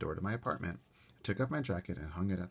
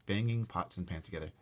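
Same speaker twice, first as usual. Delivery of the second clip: usual speaking voice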